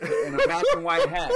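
laughing through the words, high and loud. Real, not acted. Man laughs while describing the loiterer's outfit, laughing. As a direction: crack up mid-description.

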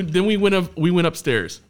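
ordinary talk, nothing marked

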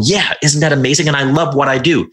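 high, eager and proud; naive excitement. Retold or imitated. Answering Bob's doubts with high, eager pride. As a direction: gushes up high, pleased with himself.

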